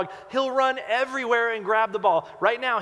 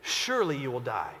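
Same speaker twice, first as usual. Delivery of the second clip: gentle warning; 4 semitones lower, 5 dB quieter than usual. Shown as a demonstration. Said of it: "God's saying to him".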